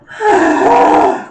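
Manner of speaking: big shouted sigh, falling; a loud high sigh that falls away; loud sighing groan. Real, not acted. Loud sigh mid-flow.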